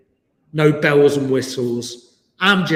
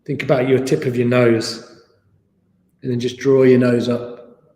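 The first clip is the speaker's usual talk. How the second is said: guided meditation; 2 semitones lower than usual. Acted. Leads guided body scan.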